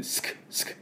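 voiceless 「スク」 with no vowel; whispered sk. Shown as a demonstration. Teacher shows the unvoiced sk ending of desk. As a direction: breathe out 「スク」 as bare s and k. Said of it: "スの音とク".

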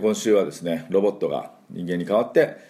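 usual speaking voice

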